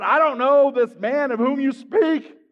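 loud, slow, high-pitched denial; aggressive denial. Acted. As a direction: deny him forcefully, drawing it out. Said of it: "So he denies him aggressively".

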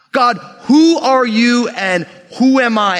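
emphatic plea. Acted. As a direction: call out to God, stretching each question. Emphatic question voiced to God.

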